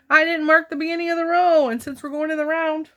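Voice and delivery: suddenly catching her own mistake; surprised realization. Real, not acted.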